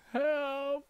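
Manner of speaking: usual speaking voice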